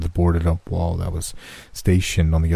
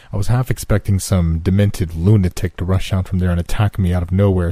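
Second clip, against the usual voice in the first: plain reading voice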